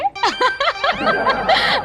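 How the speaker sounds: long high-pitched laugh; high giggle. Acted. Clerk gossiping with her friend on phone, giggling.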